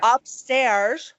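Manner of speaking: very slow, each word spelled out; slow insistent explanation. Pretend. Caller re-explaining to emergency operator, slow and deliberate. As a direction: spell it out word by word, mock-patient. Imagined call continues after interjection.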